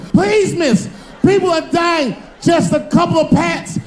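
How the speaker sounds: desperate begging. Acted.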